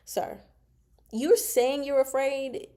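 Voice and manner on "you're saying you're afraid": slow, rising disbelief, pitched up; incredulous. Real, not acted. Reader mocks the terrified minister, slow and disbelieving. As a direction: drag it out in mock disbelief. Mocking the character.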